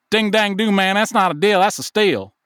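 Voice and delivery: loud, high-pitched imitation of a Southern friend; Southern accent. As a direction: imitate an excitable Southern good ol' boy. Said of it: "there are insane accent differences".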